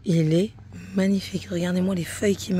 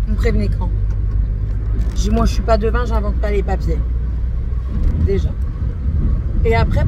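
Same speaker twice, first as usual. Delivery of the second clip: louder, heated complaint; angry retelling; 2 semitones higher, 5 dB louder than usual. Retold or imitated. Retelling her angry call to the bank, heated and loud. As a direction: vent it like the call still stings. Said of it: "Je me suis énervée".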